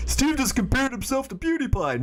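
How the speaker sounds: high-pitched mocking critic voice; mock accuser. Streamer parodies viewers twisting his comparison, high mock accusation.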